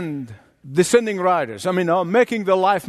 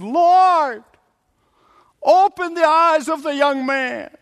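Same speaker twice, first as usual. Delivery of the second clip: fervent prayer; 9 semitones higher, slower than usual. Retold or imitated. Elisha's prayer quoted.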